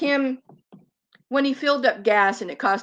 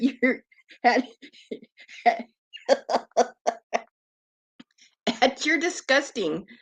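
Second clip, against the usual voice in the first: laughing while mocking, words broken by laughter; can barely get it out for laughing; laughing through words. Real, not acted.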